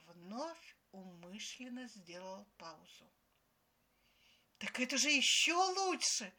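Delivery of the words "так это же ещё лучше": loud, high excited exclamation; naive delight. A narrator's reading. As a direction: burst out, thrilled by the news. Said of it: "попалась в расставленный мною капкан моя наивная Ксюша".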